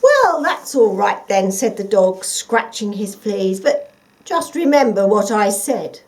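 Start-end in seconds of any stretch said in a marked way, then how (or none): 0.0-1.5 loud dog voice, a bit higher; gruff dog. Acted. The watchdog answering the fox, loud dog voice. 3.6-6.0 gruff dog. Acted. The watchdog answering the fox, loud dog voice.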